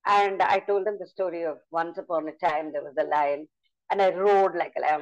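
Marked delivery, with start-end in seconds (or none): none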